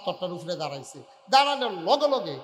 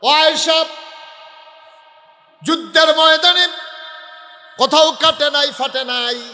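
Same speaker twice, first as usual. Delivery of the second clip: full-force preaching; 8 semitones higher, 14 dB louder than usual. Acted. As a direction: cry it out to the whole gathering. Preaches at full force, quoting Prophet. (ওয়াজের সুরে উঁচু গলায় চিৎকার)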